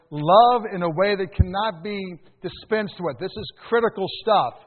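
normal talking voice

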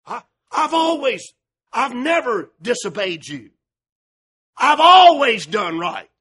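bragging. Retold or imitated. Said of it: "He's bragging on himself".

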